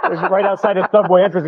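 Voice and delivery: usual speaking voice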